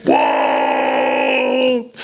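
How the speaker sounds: long drawn-out "whoa" holler; Big Show. Retold or imitated. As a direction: imitate a big wrestler's stretched-out reaction. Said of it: "Big Show's like".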